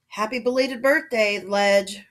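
irritated, forceful growl. A narrator's reading. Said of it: "Rocket growled".

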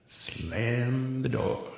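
very slow, drawn out, slammed the door; dad tick repeat. Shown as a demonstration. Comic dad repeating slammed the door, very slow. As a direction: let the dad tick drag each word out slowly. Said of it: "I got the dad tick going on".

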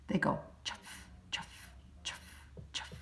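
whisper-soft shoe sounds on a path; soft footsteps. A narrator's reading. Narrator imitating father's shoes on a shady path. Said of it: "They make a soft sound".

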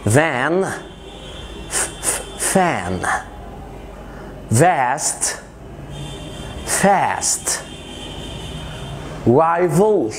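voiced v buzzed, f breathed; van with a buzzing v, fan with a hissed f; v against f. Shown as a demonstration. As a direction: pairs for the learner to repeat. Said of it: "قول معايا".

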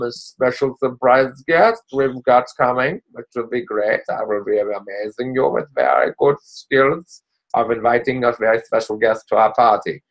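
put-on a playful German villain accent, teasing a surprise guest; mock German accent. Coach teasing the team about a surprise guest, in a mock German accent. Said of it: "I don't know why I'm talking in German".

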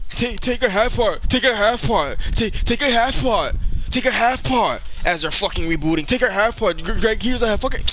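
urgent gamer callout; frantic repeated callout. Real, not acted. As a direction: hammer the instruction again and again. Repeated frantic instructions in fight.

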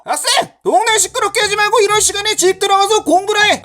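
high, fast telling-off; scolding older man. Acted. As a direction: scold the kids in one rapid burst. (높고 빠르게 꾸짖는 아저씨 말투)

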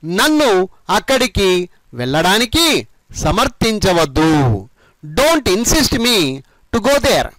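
slow, phrase by phrase with pauses; measured model sentence. Shown as a demonstration. Teacher modelling the whole translated sentence, phrase by phrase. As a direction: draw out each phrase for learners. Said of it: "మొత్తం కలిపి ఈ విధంగా చెప్పండి".

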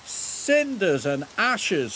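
high, drawn-out dismayed exclamation; startled little engine; spluttering. A narrator's reading. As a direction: splutter it in shock, pitched high. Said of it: "spluttered Thomas".

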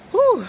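loud high whew blown out from the heat; hot exhale. Real, not acted. Hot walk in the sun.

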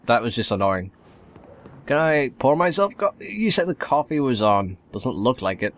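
ordinary delivery

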